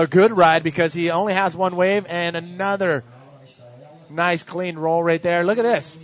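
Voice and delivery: loud, excited heat commentary; live play-by-play. Real, not acted. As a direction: call the ride live as it happens.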